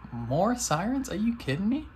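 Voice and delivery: high, incredulous at the sirens; annoyed at the sirens outside; high exasperated disbelief; real exasperation. Real, not acted. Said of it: "fifth police car".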